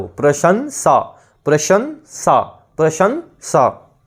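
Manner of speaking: प्रशंसा drawn out slowly to end; slow syllable demo. Shown as a demonstration. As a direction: stretch प्रशंसा so each sound is heard. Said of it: "last में सरल वाला स". (प्रशंसा धीरे-धीरे बोलकर)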